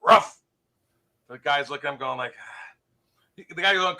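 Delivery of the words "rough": high, loud single bark; yelped doggy answer; talking dog. Acted. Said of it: "The dog goes".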